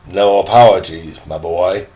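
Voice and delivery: a hint of a low old gentleman's voice; lightly voiced, courtly old man reassuring the boy. Old Virginian gentleman speaks to boy.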